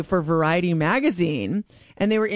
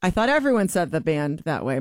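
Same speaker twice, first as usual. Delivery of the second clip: usual speaking voice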